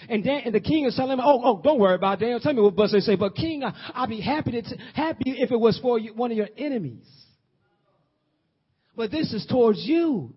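high, loud preaching, lines flung out; preaching at full force. Acted. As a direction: preach it with fire. Preacher voices Daniel pleading.

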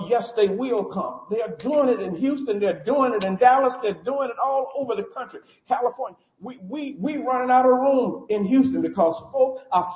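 high, loud, rolling sermon cadence; full-force preaching. Acted. Pastor preaching loud and high to the congregation. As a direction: preach it hard to the church.

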